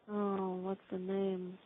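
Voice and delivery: ordinary delivery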